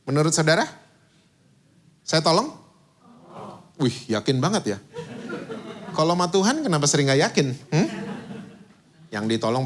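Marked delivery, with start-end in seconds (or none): none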